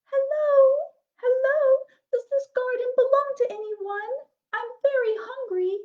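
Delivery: high, loud calling out. A narrator's reading. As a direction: call over the wall. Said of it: "she called out".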